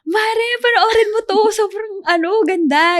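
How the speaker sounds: high, loud, gushing recommendation; hyped-up brother. Retold or imitated. Older brother hyping a movie to Gemma, high and gushing. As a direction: sell the movie to Mare, eagerly. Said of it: "So parang".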